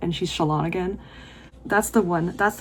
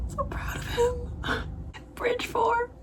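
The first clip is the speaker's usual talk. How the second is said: high, soft, crying whisper; real happy crying; tearful pride; 7 dB quieter, slower than usual. Real, not acted. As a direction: choke it out through happy tears. Tearful joy over character.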